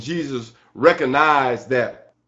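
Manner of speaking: ordinary delivery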